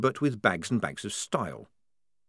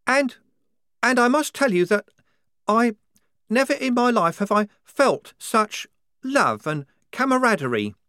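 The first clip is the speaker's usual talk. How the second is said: higher, louder, heartfelt and sincere; earnest higher young soldier; 12 semitones higher, 6 dB louder than usual. A narrator's reading. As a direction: pour out sincere devotion. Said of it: "Wazza went on earnestly".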